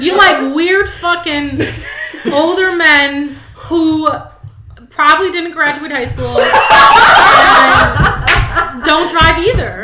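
high, loud and slow, laughing through it; slow, laughing teasing of a friend; laughing tease. Real, not acted. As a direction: roast her while cracking up.

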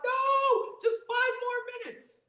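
put-on very high childish whine; whiny kid. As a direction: plead like a kid refusing bedtime. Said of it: "I was like".

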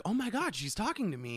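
high mock-starstruck gush; giddy crush. Young man reacting as his crush talks to him, giddy. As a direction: start high, thrilled and disbelieving.

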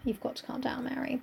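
calm her down in a hush; mock soothing. Telling fainting Mary to calm down, hushed and soothing.

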